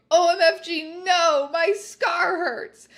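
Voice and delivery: loud high pained scream, slowing at the end. Acted. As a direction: scream as the scar burns. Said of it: "He just started to scream".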